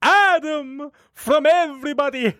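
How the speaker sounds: slow, lightly hammed vampire voice; Dracula voice. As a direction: drag it out like Count Dracula. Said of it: "doing the Dracula voice".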